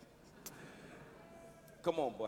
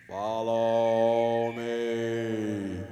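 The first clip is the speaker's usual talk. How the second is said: slow, low, otherworldly creature voice; alien voice; 6 dB quieter, much slower than usual. Acted. Creature luring the speaker: slow low alien voice. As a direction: drag it out deep like an alien.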